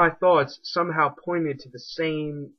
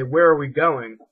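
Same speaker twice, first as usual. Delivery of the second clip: repeating the question louder; loud asking; 8 dB louder than usual. Retold or imitated. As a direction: ask it out loud, word by word. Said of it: "I would say".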